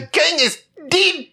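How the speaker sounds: high, hammed-up Aussie accent; mock the Australian listener's accent; overdone Australian accent voice; Australian impression. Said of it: "Stop mocking".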